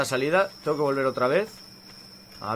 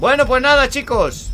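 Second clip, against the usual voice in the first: high, loud exclamation of giving up; loud resignation; 9 semitones higher, 7 dB louder than usual. Real, not acted. Gamer to viewers after failing, loud resignation.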